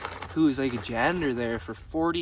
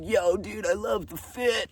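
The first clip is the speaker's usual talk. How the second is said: put-on teen dude voice; 6 semitones higher than usual. Teenagers praising outfit.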